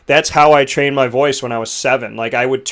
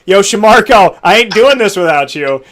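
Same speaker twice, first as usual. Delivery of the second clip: a touch higher and louder, hyped; excited call; 6 semitones higher, 6 dB louder than usual. Retold or imitated. Singer inviting Shamarco to sing, lightly hyped. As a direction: pull your buddy up with you. Imagined calling friend at wedding.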